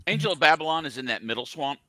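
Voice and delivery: usual speaking voice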